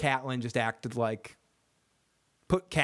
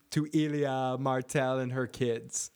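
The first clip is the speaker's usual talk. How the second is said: ordinary talk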